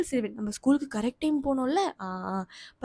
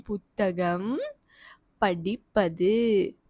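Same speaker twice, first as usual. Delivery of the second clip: slow spelling out; 4 semitones lower, much slower than usual. Shown as a demonstration.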